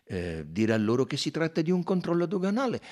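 amused Belgian sleuth pointing out the clue; Poirot's voice. A narrator's reading.

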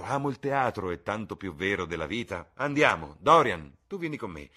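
high, loud, lively rallying; rally everyone out, calling the name loudly; eager. A narrator's reading. Lord Henry hurries Dorian off to theatre, excited and loud.

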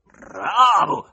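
loud growled cheer; brief bass growl. A narrator's reading. Man in the gallery cheers, short growling shout. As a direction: roar it out in one short burst. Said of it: "ringhiò brevemente una voce di basso".

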